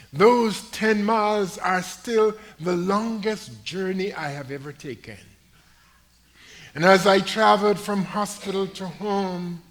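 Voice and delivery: loud ringing pulpit proclamation; full-throated sermon climax; preaching at full force. Acted. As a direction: lift the story to the back pews. Preacher building the testimony.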